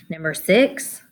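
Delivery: ordinary delivery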